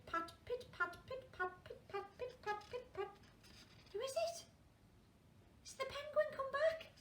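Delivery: usual speaking voice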